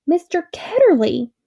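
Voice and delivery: high, loud call of recognition; shouted name. A narrator's reading. The girl remembering, shouting the name. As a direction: shout it out as memory snaps back. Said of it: "she shouted out".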